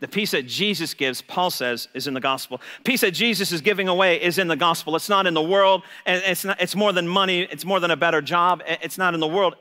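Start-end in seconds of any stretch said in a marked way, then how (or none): none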